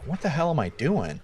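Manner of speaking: soft, appalled question to oneself; quiet self-disgust. Acted. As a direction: mutter it to yourself in dawning realisation. Acted disgusted moment of self-realisation.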